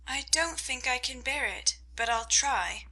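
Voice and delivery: soft, hushed thought; quiet inner thought. A narrator's reading. Amy left alone with the aunt, hushed. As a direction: say it softly to yourself. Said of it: "thought Amy".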